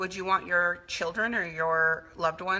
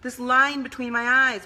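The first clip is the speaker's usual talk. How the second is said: higher, louder mock of mom hating her face; imitating disgusted mother; 5 semitones higher, 4 dB louder, slower than usual. Daughter imitates her mother griping at the mirror, higher and louder. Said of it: "she would say".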